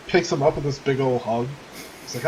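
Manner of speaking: usual speaking voice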